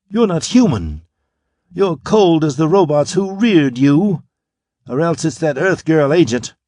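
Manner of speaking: rising fury, pitched high; furious. A narrator's reading. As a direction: spit it out in a rage. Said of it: "She recoiled furiously from him".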